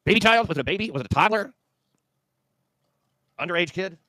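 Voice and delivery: high incredulous mock questions; mocking disbelief. Real, not acted. Mocking the headline's word child.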